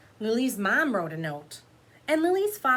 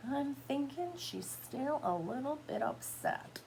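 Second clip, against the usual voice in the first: hushed high aside to the class; soft aside; 5 semitones higher, 7 dB quieter than usual. Real, not acted. Reading teacher murmuring an aside to children.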